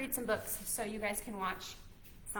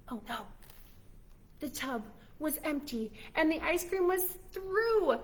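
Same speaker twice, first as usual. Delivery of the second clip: ordinary talk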